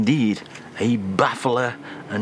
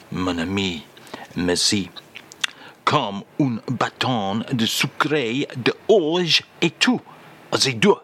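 French phrase. Acted. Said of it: "If you will again, please, [name]".

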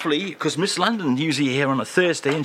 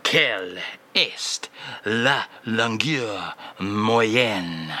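put-on slow, low French-accented crooner voice; a slow French charmer's voice; 5 semitones lower, much slower than usual. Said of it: "say that again, please, [name]".

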